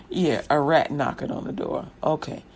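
low, quiet deadpan reply; dry dismissal. Acted. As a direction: drop it low, unimpressed. Mayor's dismissive reply to knock.

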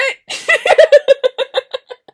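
high shouted cackle of laughter; loud shrieking laugh. Real, not acted. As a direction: scream with laughter. Laughing fit continues.

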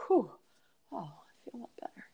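fast breathy whisper of relief; relieved whisper. Retold or imitated. After listing what she won't stand for, whispering relief. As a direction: let it out in a rushed whisper. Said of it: "I was like".

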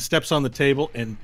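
normal talking voice

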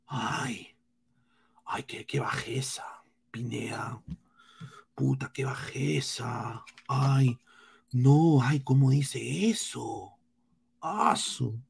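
soft, half-whispered fake outrage; mock their prissy shock at the insult; parodying offended Peruvian fans, soft and whiny; mocking whiny critics. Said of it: "si yo le digo eso a ellos".

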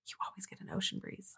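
soft, breathless whisper of the line; whispered movie quote. Retold or imitated. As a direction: whisper it like the creepy stranger on the plane. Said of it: "and he's like".

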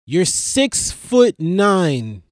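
normal talking voice

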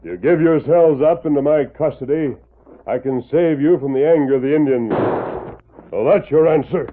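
stern loud surrender demand; commanding call. Acted. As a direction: order them out with authority. Ranger orders outlaws to surrender.

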